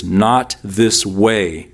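not this way, slow and weighted, every word leaned on; emphatic stress. Shown as a demonstration. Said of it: "in the Greek are very emphatic".

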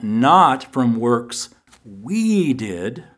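"not" punched and the line slow and deliberate; stress on not. Shown as a demonstration. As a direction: hit "not" hard, then draw out "from works we did". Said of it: "the emphasis on the word not".

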